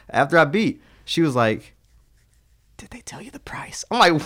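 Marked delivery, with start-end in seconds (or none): 2.8-3.8 whispered question. Retold or imitated. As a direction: imitate her hushed, whispered question. Retold bedroom question from woman.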